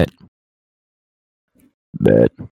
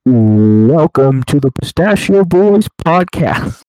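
slow, loud podcast host intro; talk show host voice; 7 dB louder, much slower than usual. Pretend. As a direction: announce it like a late night host. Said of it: "that talk show host voice".

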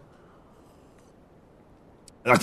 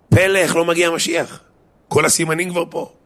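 raised pitch, loud opening, retold amazement; astonished wonder; 7 semitones higher, 4 dB louder than usual. Quoting an old rabbi puzzled by the Messiah's delay, astonished. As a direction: exclaim it as a baffled outcry.

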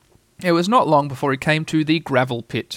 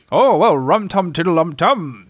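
slow singsong bear voice; bumbling, drawn-out humming chant; Pooh Bear; 3 semitones higher, 4 dB louder, slower than usual. A narrator's reading. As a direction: chant it slowly like a pottering bear. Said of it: "in a voice just like Pooh Bear's".

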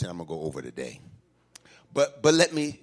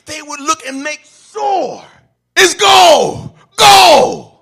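loud, higher climb on gold; rising preaching shout; 13 semitones higher, 18 dB louder, slower than usual. Acted. Preacher shouting about miners checking for gold. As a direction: build it up and hit gold hard.

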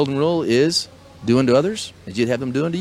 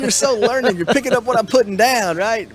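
high, bright hyped-up praise for a sharp student; cheering him on, pitch way up; excited praise; 10 semitones higher, 4 dB louder than usual. Real, not acted. Host hyping up his guest, excited and much higher.

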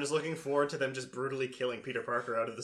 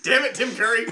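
laughing curse; 6 semitones higher, 9 dB louder than usual. Pretend. Mock quote, laughing.

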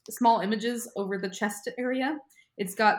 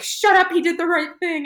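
hammed-up shout, much higher and louder; mock yelling; 11 semitones higher, 9 dB louder than usual. Anzu defending Yugi from the friends, mock yelling. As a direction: snap back at them in a mock scream. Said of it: "they're all just screaming at each other".

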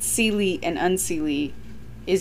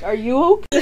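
laughing quote; 4 semitones higher than usual. Retold or imitated. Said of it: "and I was like".

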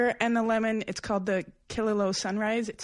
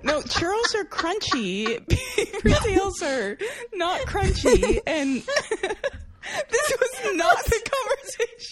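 laughing protest; 10 semitones higher, 4 dB louder, slower than usual. Real, not acted. Laughing protest about pastries.

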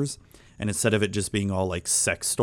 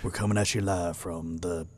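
low, slow, hushed spooky whisper; spooky late-night radio host; 4 semitones lower, 9 dB quieter than usual. Pretend. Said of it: "real chill and real quiet and real spooky".

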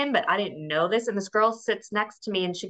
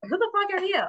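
higher, hammed-up snap at a stranger; mock hostile retort; 8 semitones higher than usual. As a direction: fire it back like you're ready to fight. Julia's retort retold.

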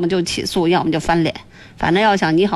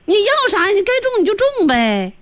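incredulous pitch jump; 9 semitones higher than usual. Real, not acted. Host baffled by the caller's demand.

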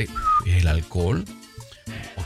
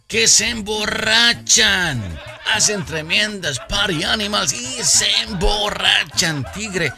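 high excited slow build; gleeful high telling; 8 semitones higher than usual. Acted.

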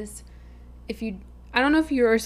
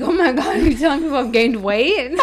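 loud, high mock outrage; shocked; 5 semitones higher, 13 dB louder than usual. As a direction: gasp it in fake horror. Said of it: "I was like".

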